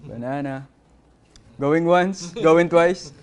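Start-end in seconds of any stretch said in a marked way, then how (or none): 1.6-3.1 auctioneer call. Pretend. Auctioneer call before result.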